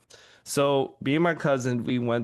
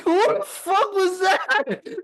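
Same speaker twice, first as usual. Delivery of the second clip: laughing disbelief; 7 dB louder, slower than usual. Retold or imitated. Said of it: "We're like".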